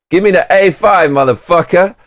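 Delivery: high, loud, cocky order; swaggering customer. Pretend. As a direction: shout it like a brash customer ordering. Acting the order again.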